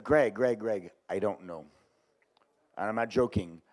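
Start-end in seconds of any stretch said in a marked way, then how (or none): 0.0-1.7 low, soft voice of a worker giving in; quietly confess, as the retold worker; imitated subdued admission. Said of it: "he said".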